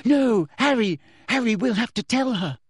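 put-on high, urgent girl's plea; desperate cracked voice. A narrator's reading. Girl urges friend to confess, desperate. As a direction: plead desperately, pitched well up. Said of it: "shouted Hermione in a cracked voice".

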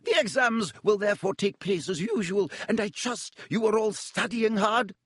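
crisp disapproving governess; high, clipped stern teacher. A narrator's reading. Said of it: "she said sternly".

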